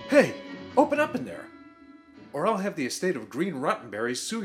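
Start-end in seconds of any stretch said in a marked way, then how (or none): 0.1-1.5 higher-pitched demand to open up; indignant call at a closed door; calling through a door. Acted. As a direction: call out to someone locked inside.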